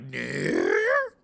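loud puppet voice right up close; Grover near. Retold or imitated. Grover teaching kids near and far, loud up close. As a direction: lean into the mic as Grover. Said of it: "be like".

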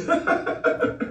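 soft wheezy cackle; mocking laughter. Real, not acted. Mocking "let me show you.

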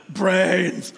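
slow, drawn-out zombie groan of brains; zombie moan. Retold or imitated. Preacher imitating zombies for the congregation, slow moan. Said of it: "what do zombies do".

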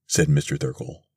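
usual speaking voice

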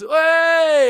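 loud high call out the truck window; shouted hey. Retold or imitated. Mate honking and yelling at a girl, loud shout. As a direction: yell it at the passing girl. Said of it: "he goes".

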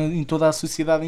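usual speaking voice

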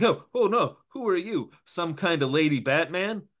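high, alarmed yelp; startled gangster. Acted. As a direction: jump in mock fright. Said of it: "the gangster's like".

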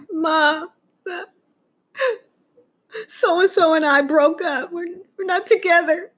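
high, tearful confession with crying breaks; mock sobbing. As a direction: wail the breakup news to mom, hammed-up. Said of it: "I'm bawling in tears, crying".

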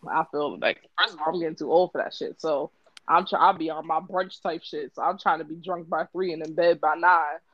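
usual speaking voice

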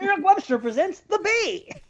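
high, hammed-up trailer voice; playful high-pitched announcer; mock movie announcer. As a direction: present it like a grand film title.

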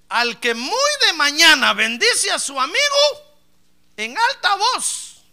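fiery preaching. Real, not acted. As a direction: thunder the proverb out. Reads proverb at full force.

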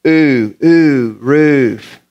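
long drawn-out "ooh" leading into "roof"; practicing pronunciation. Shown as a demonstration. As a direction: rehearse the long oo in "roof" slowly. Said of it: "sit there and practice that".

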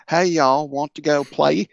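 put-on a neighbourhood kid in a Southern accent; Southern kid calling a friend to play; Deep South kid. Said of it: "the kid you're playing with is like".

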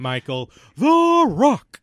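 slow, loud, high booming intro; wrestling announcer. Pretend. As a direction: boom it like a ring announcer.

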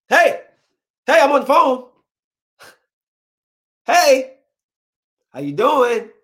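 high, insistent phone hail; calling for attention. Acted. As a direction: call out to a distracted person on the line. Calling out over background conversation on phone.

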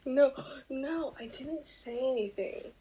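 ordinary talk

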